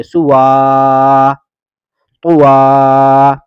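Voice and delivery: teacher holding the ا in سوا, قوا very slowly; stretched vowel. Shown as a demonstration. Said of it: "واو کو کھینچ کر پڑھیں گے".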